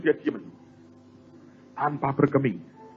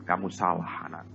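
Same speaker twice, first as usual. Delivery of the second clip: God's voice, lower; 8 semitones lower, 9 dB quieter than usual. Retold or imitated. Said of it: "Tuhan ngomong begini".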